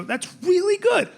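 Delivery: high, drawn-out encouragement; slow down and lift it kindly; indulgent praise. Acted. Dad spares son's feelings, high slow praise. Dad softening the truth for son.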